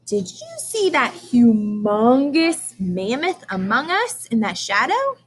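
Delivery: ordinary talk, nothing marked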